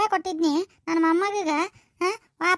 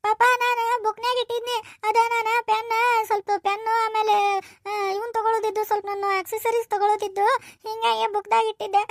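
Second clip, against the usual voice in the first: put-on higher girlish voice, upset; young daughter voice; 3 semitones higher than usual. Daughter complaining to mother about her stolen money, girlish. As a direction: play the teenage girl complaining.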